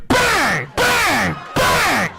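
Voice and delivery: high loud shouted bangs; mock loud bangs. Comic voicing the woman's thrusts, loud shouts. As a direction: belt each bang. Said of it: "She's going like".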